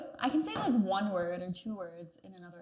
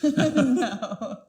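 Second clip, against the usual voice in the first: laughing; 5 semitones higher, 10 dB louder than usual. Real, not acted. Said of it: "I, no".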